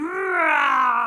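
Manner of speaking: huge shouted roar; very loud, long animal roar; lion roar. Pretend. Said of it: "Roar like a lion".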